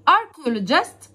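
archaeologist drawn out very slowly, ch said as K. Shown as a demonstration. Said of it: "السي والاتش ... بصوت حرف الكي".

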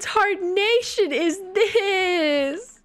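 high, very slow hammed-up cowpoke drawl; mock hillbilly outrage. Gamer mocking her weird Sim in a hammed-up prospector voice. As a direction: ham it up like an old prospector.

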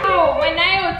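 ordinary talk

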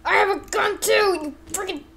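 high-pitched wounded outburst; strained, high acted fury; pained cursing. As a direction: curse in short high bursts through play-acted pain. Wounded character curses and threatens back.

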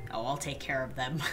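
hushed, low, ominous promise; quietly menacing joke; mock sinister. Player joking about her farm animals, hushed mock menace.

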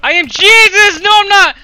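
loud, high, over-the-top boast; hammed-up triumphant yelling; exultant shouting. Real, not acted. Mock boasting after a kill.

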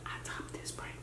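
whisper. Real, not acted. Gloating quietly while hiding.

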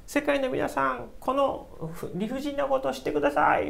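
high, pleading delegates begging the world; pleading envoys. Retold or imitated. Korean envoys appealing to the world, high and pleading. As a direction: beg the conference for help, voiced high. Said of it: "みたいな感じで".

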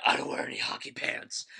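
husky whispered hero voice; lightly rasped mock Batman.

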